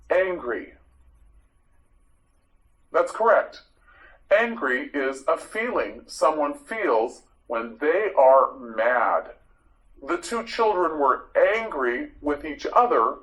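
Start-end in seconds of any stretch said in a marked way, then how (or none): none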